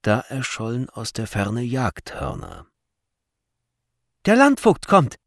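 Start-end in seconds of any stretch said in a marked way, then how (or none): 4.2-5.1 high, loud women's voices; fearful high-pitched shout; alarmed cries. A narrator's reading. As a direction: call out in fright as danger approaches. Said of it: "riefen einige Frauen".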